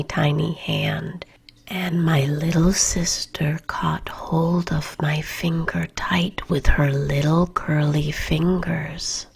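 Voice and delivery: plain reading voice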